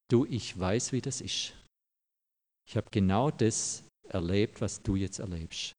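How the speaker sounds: soft, hushed words of comfort; gentle comfort. Acted. A friend consoling someone in a grim hour: soft, gentle. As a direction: speak quietly, like an arm around a shoulder.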